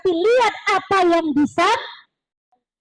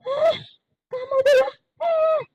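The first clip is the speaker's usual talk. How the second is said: scolding teacher; 9 semitones higher than usual. Retold or imitated. Teacher scolding a crying child.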